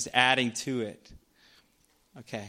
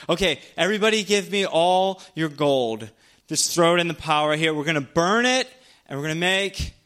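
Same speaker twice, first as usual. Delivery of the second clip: loud, raised-pitch take-charge voice; acted rallying orders; 4 semitones higher, 4 dB louder than usual. Aaron rallies the bored people, loud and commanding. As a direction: call out a hasty plan to the crowd.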